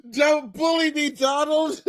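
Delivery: high, whiny pleading voice; whiny Maduro impression. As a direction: plead like a bullied kid.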